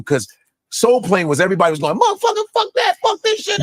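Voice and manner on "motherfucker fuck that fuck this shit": high-pitched mock outburst; mock cursing crowd. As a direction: imitate everybody cursing at once, much higher. Acting out everybody on Soul Plane.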